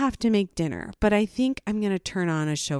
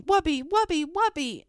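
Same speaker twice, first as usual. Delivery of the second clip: high repeated mock screech; imitated child scream; 10 semitones higher than usual. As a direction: imitate a little girl screaming for her toy. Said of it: "she's screaming".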